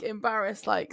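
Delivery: ordinary talk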